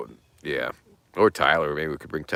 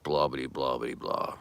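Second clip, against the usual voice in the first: trailing, fading, softer blah blah; dismissive blah; 5 semitones lower, 7 dB quieter than usual. Real, not acted. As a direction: shrug off the rest.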